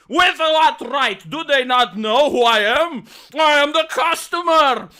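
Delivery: loud, slow, indignant ranting shout; outraged customer. Retold or imitated. Romanian customer raging at Burger King, loud outraged rant. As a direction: bellow it like an insulted customer who is always right. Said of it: "would go outraged".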